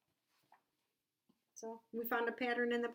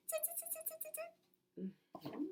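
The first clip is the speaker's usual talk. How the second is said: quick repeated tongue clicks; chattering monkey tsks; monkeys' tsk-tsk; 12 dB quieter than usual. A narrator's reading. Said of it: "shook both their hands back at him and said".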